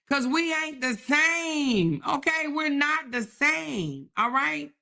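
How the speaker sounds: taunting. Real, not acted. Taunting rival on stream.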